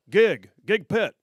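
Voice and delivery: loud, slow drill sergeant order; barked order. Retold or imitated. Sergeant sending the speaker to the gig pit, slow and loud. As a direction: bark the sentence like a drill sergeant.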